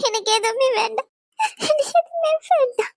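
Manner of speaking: high, sobbing refusal; crying. Acted. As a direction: refuse everything, dissolving into a wail. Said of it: "அழுகாதடி".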